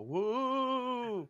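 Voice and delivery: short spooky ghost woo; a quick haunting woo out of nowhere; ghostly wail. Acted. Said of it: "make a ghost sound go".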